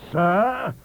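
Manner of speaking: high, loud roar of one word. A narrator's reading. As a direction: bellow it to silence the table. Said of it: "he roared".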